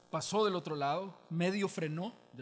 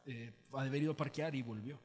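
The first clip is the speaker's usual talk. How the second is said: ordinary delivery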